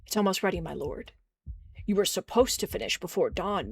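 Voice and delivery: ordinary talk, nothing marked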